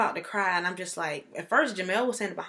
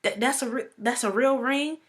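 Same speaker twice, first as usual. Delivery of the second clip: higher, questioning acted disbelief; retold astonished question; confused disbelief; 5 semitones higher than usual. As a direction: replay stunned doubt, rising at the end. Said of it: "'Cause I was so confused".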